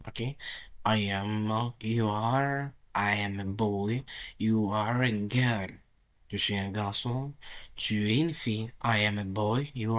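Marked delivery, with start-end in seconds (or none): none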